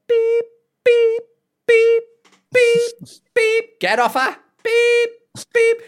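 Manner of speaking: high, loud mechanical beep-beep; mock wheelchair reversing beeps. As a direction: imitate a reversing wheelchair alarm, pitched high.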